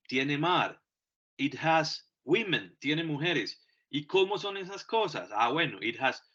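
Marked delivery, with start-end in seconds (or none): none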